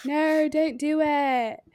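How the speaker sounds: high, stretched mock British plea; British accent. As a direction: drag it out in put-on British. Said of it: "British people just".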